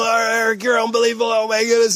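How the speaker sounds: high, run-together cheer of adulation; gushing crowd. Acted. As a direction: mimic fans fawning over a champion. Acting the adoring crowd's praise.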